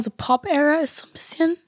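strained voice breaking away; voice giving out. Real, not acted. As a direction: voice cracking, going thin. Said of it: "meine Stimme geht einfach weg gerade".

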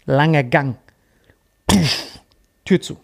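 normal talking voice

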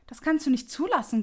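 put-on old miser begging the ghost to stop it; Scrooge cries out. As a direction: cry out against the ghost. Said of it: "rief Scrooge".

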